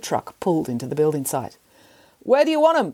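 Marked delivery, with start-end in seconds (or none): 2.2-2.9 calling up. Acted. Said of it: "the foreman called up".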